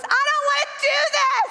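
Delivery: high, loud temper-tantrum yell; re-enacted full-voiced tantrum; tantrum shout. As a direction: scream it like a child refusing. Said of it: "shouted again at the top of my voice".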